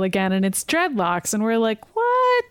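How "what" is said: a sudden high squeak of disbelief; squeaked stunned what; shocked what. Retold or imitated. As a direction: jump way up in mock shock. Recapping shocked reaction to scene.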